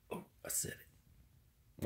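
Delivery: sudden hushed whisper; guilty little whisper aside. Real, not acted. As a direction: whisper it like you've said too much. Blurted something risky, hushes.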